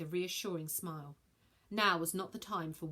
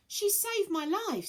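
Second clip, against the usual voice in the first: put-on earnest boy; 12 semitones higher, 4 dB louder, slower than usual. Elliot earnest, high.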